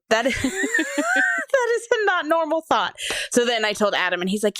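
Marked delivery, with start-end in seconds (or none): 0.1-3.0 laughing disbelief. Retold or imitated. Laughing at her own strange thought.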